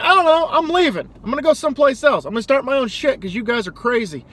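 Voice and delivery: hammed-up fed-up colonist quitting; mock defiant colonist. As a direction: squeak out a huffy exit. Acted colonists quitting England.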